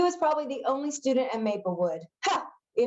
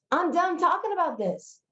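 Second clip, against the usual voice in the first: higher-pitched, exasperated shutdown; firm refusal; 9 semitones higher than usual. Acted. Jackson refuses to plan cons anymore, firmly shutting it down. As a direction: put an end to the discussion.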